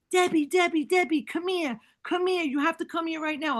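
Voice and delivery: mock urgent summons; urgent calling. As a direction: call her over urgently. Kids calling her urgently.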